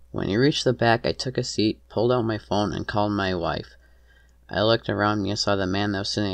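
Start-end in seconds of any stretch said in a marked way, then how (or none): none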